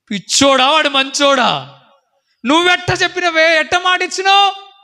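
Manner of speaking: high, loud scolding; high-pitched, loud rebuke of a foolish widow; scolding friend. Retold or imitated. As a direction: berate her friend for the promise. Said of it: "తిట్టింది, తిట్టింది".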